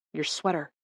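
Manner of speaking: soft, hushed whisper. Acted. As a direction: whisper a quick warning. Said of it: "Vance whispered".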